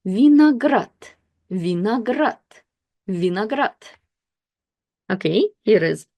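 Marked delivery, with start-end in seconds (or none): 0.1-3.8 vinagrat read slowly with O reduced to A, stress on the last syllable; careful Russian reading. Shown as a demonstration. Teacher reading vinagrat aloud for learners, slow and careful. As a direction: sound out vinagrat slowly, the unstressed O said as A. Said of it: "So you will read this word as".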